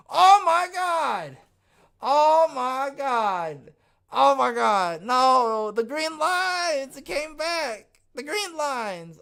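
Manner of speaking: high, loud, slow mock astonishment; mock shocked. Streamer gasps at the green lines in mock shock. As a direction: ham up the shock at the chart.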